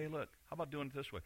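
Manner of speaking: soft and quick, an easy nudge; gentle suggestion. Retold or imitated. Jesus gently redirecting a believer, soft and quick. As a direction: say it lightly, a soft aside. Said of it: "He's just gonna say".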